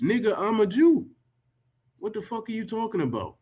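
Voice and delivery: high, exasperated disbelief; incredulous retort. Real, not acted. Rebutting the article's writer, in high exasperated disbelief. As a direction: fire back in disbelief.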